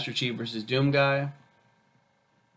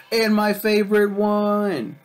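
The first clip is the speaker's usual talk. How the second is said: higher, louder, drawn out gush; excited; 9 semitones higher, 5 dB louder, slower than usual. Real, not acted. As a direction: stretch it out in delight. Gushing about favorite episode.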